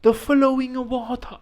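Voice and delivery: slow, high-pitched mock Chinese accent; put-on Chinese accent, drawn out like a solemn saying. Speaker quoting a beautiful Chinese saying, mock Chinese accent. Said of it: "This is my Chinese accent".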